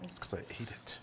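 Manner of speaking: guilty whispered confession. Real, not acted. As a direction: whisper it like a sheepish admission. Confessing he ate the piece.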